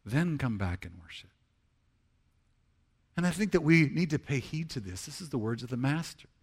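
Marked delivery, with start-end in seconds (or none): 0.1-1.3 softer, lower echo of the command; quiet repeat. Acted. Preacher repeating the command for the congregation, quietly. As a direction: drop down and let it sink in.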